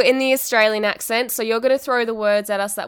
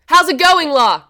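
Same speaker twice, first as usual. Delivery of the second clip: high, loud, with "la" joined on; loud exclamation; 6 semitones higher, 7 dB louder, slower than usual. Shown as a demonstration. Student retrying the slang greeting for the teacher, loud exclamation. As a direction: belt it out as an exclamation, no gap before "la". Bigger retake, exclamation not question.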